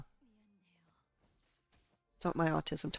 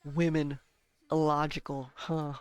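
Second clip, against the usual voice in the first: dismissive scoff; 2 semitones lower, 3 dB louder than usual. Retold or imitated. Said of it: "you just turn and go".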